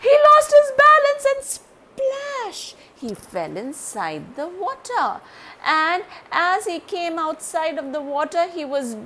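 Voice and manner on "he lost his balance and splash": loud, high, building to splash; excited narration. A narrator's reading. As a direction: tell the fall with big excitement. Dramatic fall into water.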